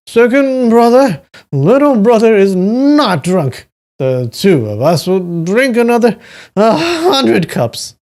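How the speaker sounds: put-on high, loud drunken slur; Duan Yu, completely drunk. A narrator's reading. Drunk little brother insisting he is not drunk, slurring. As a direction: insist tipsily, stretching the words out. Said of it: "Duan Yu was completely drunk".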